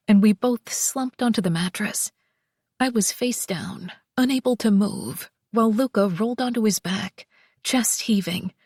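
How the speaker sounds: usual speaking voice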